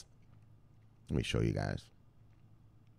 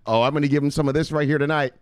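ordinary delivery

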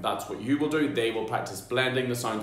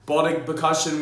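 normal talking voice

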